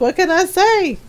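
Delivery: high, playful shrug in the voice; playful boast. Retold or imitated. Replying to Ron about the inherited sass, high playful boast. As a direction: toss it off high and pleased.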